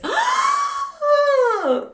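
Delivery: long, high theatrical gasp; shocked gasp. Acted. Vlogger told she dislikes Texas, exaggerated shocked gasp. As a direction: draw out an appalled gasp.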